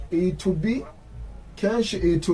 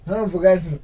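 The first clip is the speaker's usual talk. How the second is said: ordinary talk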